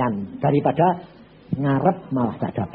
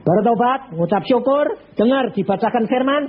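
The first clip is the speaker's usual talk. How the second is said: urgent preaching; 9 semitones higher, slower than usual. Retold or imitated. Pastor urging the dying banker to repent, urgently. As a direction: press him hard to repent.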